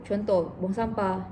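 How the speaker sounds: usual speaking voice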